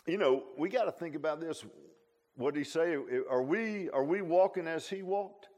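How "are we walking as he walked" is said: normal talking voice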